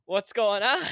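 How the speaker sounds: high, loud imitation of a worried mom; worried-mother impression, voice lifted high; Laura's worried voice. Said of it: "the Laura just worried voice that she gets".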